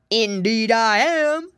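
loud, slow, relishing wolf; menacing wolf. A narrator's reading. Wolf answers frightened Pinky, with slow menace. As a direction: draw it out loud and hungry. Hungry wolf threatens captured pigs.

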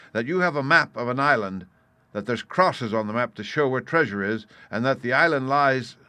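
lightly voiced captain laying down his objections; blunt captain, airing grievances. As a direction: state it firmly, punching key words.

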